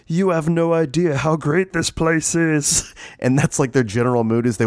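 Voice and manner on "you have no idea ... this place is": dog voice. Retold or imitated. Dogs voiced by owner.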